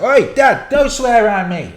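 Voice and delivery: put-on high, loud shout of a scolding kid; imitating son shouting at dad; mock child shout. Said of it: "he just shouts it".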